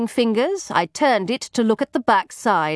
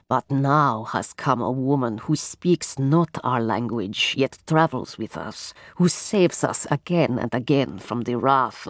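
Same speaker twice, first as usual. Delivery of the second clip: low softly rasping voice; 9 semitones lower than usual. Acted. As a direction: speak like a solemn fortune teller. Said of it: "her low and softly rasping voice".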